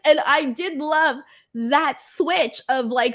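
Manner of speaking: normal talking voice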